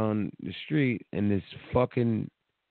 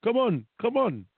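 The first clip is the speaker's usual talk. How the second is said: higher eager beckon; beckoning stranger; 9 semitones higher than usual. Retold or imitated. Stranger waving him over, higher urgent beckon. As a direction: wave him on, urging.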